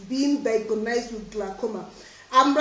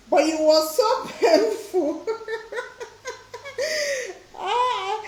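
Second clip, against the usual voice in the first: real giggles breaking up the words; laughing; 8 semitones higher, slower than usual. Real, not acted. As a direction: read it while losing it to laughter. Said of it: "sorry I'm laughing though".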